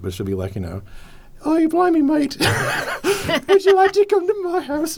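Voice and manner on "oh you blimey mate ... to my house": put-on English accent, very high, loud and laughing; impression of the alien's English accent in the film; mock English accent. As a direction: ham up a silly English seductress voice. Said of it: "She's speaking with an English accent".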